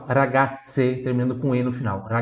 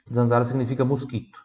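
ordinary delivery

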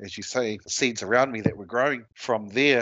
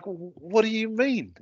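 surprised; 11 semitones higher, slower than usual. Retold or imitated. Wife says he has bad attitude.

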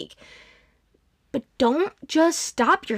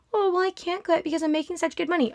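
mock-whiny excuse, higher and quick; whiny coworker; 6 semitones higher, 4 dB quieter, faster than usual. Mocking a complaining coworker, whiny and quick. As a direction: mimic a lazy coworker's excuse, fast and high. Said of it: "I'd look at you and be like".

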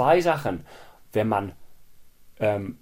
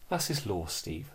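soft, quieter, patronising like a therapist; condescending tone; 3 semitones lower, 9 dB quieter than usual. Retold or imitated. Colleague talks down to Steve, soft and patronising. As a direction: talk down to him gently, like a granny soothing a child. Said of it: "In genau diesem Ton".

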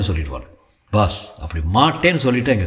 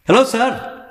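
much higher call across the room; 14 semitones higher, much slower than usual. Acted. Vasanth calls a greeting to the father from across the room. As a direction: call out to the phone from afar. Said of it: "இங்கிருந்து இறைந்தார்".

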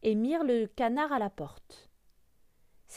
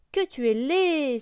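loud, high, drawn-out taunt; exclaiming turkey; 6 semitones higher, 7 dB louder, slower than usual. A narrator's reading. Turkey insults the grey duckling, crying out. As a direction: cry it out slowly and loudly. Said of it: "s'écria le dindon".

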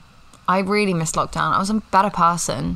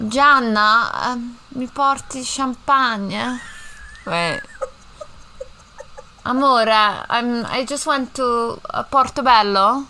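higher, Italian lilt, slow; Italian accent; 5 semitones higher, slower than usual. Pretend. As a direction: play an Italian in London. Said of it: "I'm Italian".

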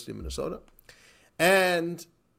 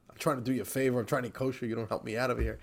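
usual speaking voice

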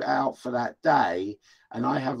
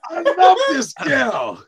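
much higher and louder, slow and gushing; delighted shout; 13 semitones higher, 10 dB louder, slower than usual. Real, not acted. Cohost cheers the wrestler's thousand-dollar price, shouting happily. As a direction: whoop it out in pure delight.

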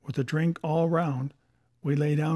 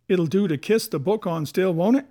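worried pirate's question, louder and rising at the end; growled question; 3 semitones higher, 6 dB louder than usual. A narrator's reading. Dick asks Silver about his cut Bible, growling. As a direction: press anxiously for reassurance, voice climbing. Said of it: "growled Dick".

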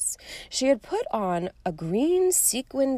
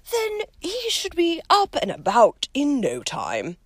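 jovial headmaster; 8 semitones higher, 5 dB louder than usual. A narrator's reading. Dumbledore jovial to first-year.

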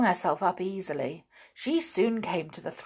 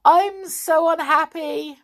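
higher, louder unhappy wail; tearful; 9 semitones higher, 7 dB louder than usual. A narrator's reading. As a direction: moan it miserably. Doll stranded, pleading.

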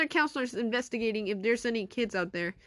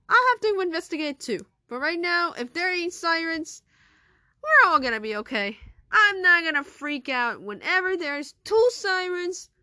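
insistent principal; 4 semitones higher, 6 dB louder than usual. Acted. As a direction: insist nobody panic.

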